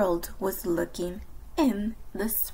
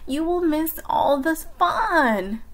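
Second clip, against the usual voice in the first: higher, mildly raised plea; crying out; 7 semitones higher, 4 dB louder than usual. A narrator's reading. Frog pleads with sleepy Toad, crying out. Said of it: "cried Frog".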